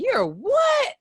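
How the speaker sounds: mother's shrill startled question; shocked mom. Retold or imitated. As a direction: play the stunned mother hearing the news. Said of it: "She was like".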